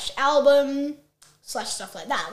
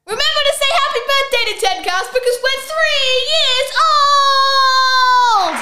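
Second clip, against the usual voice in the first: excited; 8 semitones higher than usual. Real, not acted. Birthday announcement.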